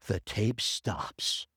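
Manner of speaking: slow deadpan reveal. Retold or imitated. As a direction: land the reveal slowly and low. Reveal after "guess what happens?.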